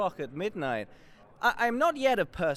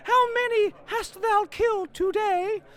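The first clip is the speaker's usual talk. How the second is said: high mock wife voice; 3 dB louder than usual. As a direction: coo it like a doting lady. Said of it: "says she".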